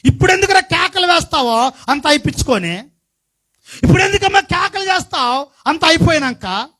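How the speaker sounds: high, loud sermon cadence; fiery preaching. Acted. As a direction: preach it out to the congregation. Preaching rebuke at full force.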